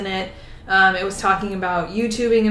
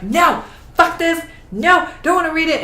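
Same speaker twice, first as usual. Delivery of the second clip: put-on toddler tantrum; 9 semitones higher, 4 dB louder than usual. Reader retelling refusing a hated book, mock toddler tantrum. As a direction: throw a mock tantrum, pitched well up. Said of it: "like, a toddler tantrum".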